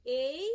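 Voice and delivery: "A" said alone, loud as a long "ay"; syllable split off. Shown as a demonstration. Teacher breaking a word into parts for students, loud isolated "A". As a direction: punch out "A" as a separate syllable, "ay". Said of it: "break it down into its constant parts".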